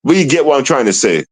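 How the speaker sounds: ordinary talk, nothing marked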